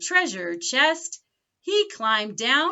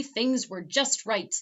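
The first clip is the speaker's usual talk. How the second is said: ordinary delivery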